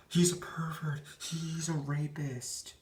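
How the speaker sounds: high, drawn-out mimicry of an outraged woman; mock accusation. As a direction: stretch it out in a high mocking voice. Voicing women's overblown accusation.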